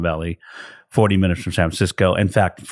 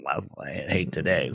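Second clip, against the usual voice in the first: mock grumbling; 2 semitones lower, 10 dB quieter than usual. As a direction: grumble it under your breath.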